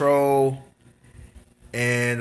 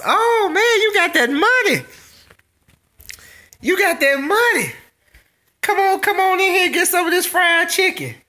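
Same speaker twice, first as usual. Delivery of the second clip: much higher put-on voice, welcoming and impressed; mock excited host. Streamer imitating country folks inviting a guest in for chicken, high mock voice. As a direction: squeal it like a delighted aunt pulling a guest in.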